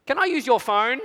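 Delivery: put-on high little girl asking sweetly; the innocent final ask; child's voice. Daughter asking dad for the phone, high and sweet.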